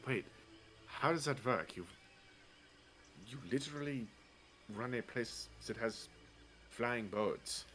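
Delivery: usual speaking voice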